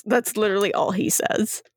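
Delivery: ordinary talk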